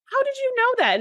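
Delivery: high, astonished question; mock surprise. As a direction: squeak it out in disbelief.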